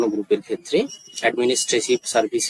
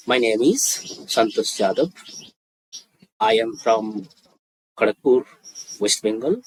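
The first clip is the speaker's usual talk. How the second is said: very slow, with long pauses between phrases; slow demonstration; much slower than usual. Shown as a demonstration. Teacher models a student's self-introduction for learners, slowly. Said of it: "আমি আস্তে আস্তে বলছি".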